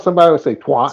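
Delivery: twat with a broad ah as in twot, Northern style; a Northern twaht. Shown as a demonstration. Explaining to Smokey, a Northern twat. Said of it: "up north".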